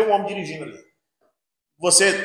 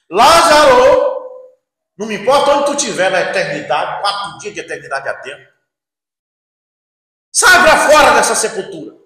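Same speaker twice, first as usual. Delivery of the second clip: commanding shout; 3 semitones higher, 8 dB louder than usual. Acted. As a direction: order the dead from the grave. Jesus commands Lazarus from tomb. (ordem alta e solene ao morto)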